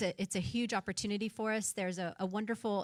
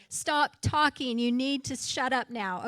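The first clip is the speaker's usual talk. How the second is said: slow, louder, mock-bossy order; mock stern timekeeper; 4 semitones higher, 6 dB louder, slower than usual. Timekeeper silencing a long-winded speaker, mock stern slow order. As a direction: play the no-nonsense timekeeper cutting someone off. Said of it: "somebody that will sit right in front and say".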